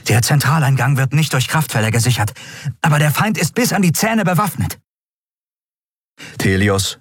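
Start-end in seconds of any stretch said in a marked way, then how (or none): 0.1-4.8 high, urgent, breathless scout voice; winged scout breathlessly reporting to the admiral. A narrator's reading. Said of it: "berichtete der Yadi atemlos".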